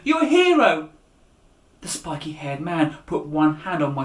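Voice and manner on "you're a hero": high, loud joyful shout; loud cry of you're a hero. A narrator's reading. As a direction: shout it out, thrilled. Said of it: "she shouted".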